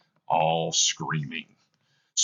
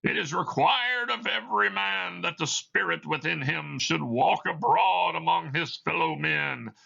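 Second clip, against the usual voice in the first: ghostly Marley voice; 9 semitones higher than usual. Acted. Dead partner answering Scrooge, eerie high ghost voice. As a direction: moan it out like a spirit.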